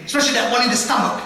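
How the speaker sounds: ordinary delivery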